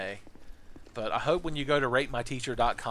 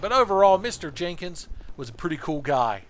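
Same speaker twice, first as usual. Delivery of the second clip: put-on higher, louder upbeat kid; mock student praise; 6 semitones higher, 6 dB louder than usual. Student's review read to the teacher, loud and upbeat. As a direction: blurt out the praise like a teen.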